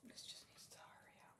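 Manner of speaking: breathy whisper to herself; fed-up whisper; impatient whisper. Real, not acted. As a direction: whisper it, willing the oven on. Impatient waiting for the oven.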